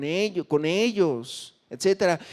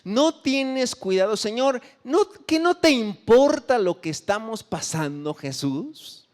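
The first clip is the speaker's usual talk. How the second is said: higher, slower, pleading reproach; desperate complaint; 6 semitones higher, 3 dB louder than usual. Acted. Disciples reproaching Jesus in the storm, desperate and pleading. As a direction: cry out to him as the boat sinks. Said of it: "estaban desesperadísimos y le reclamaron".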